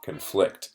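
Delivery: "conflict" with a reduced con and a strong FLICT; stress on second syllable. Shown as a demonstration. Teacher repeats the verb for students, stress on the FLICT of "conflict". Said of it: "the stress is on a different syllable".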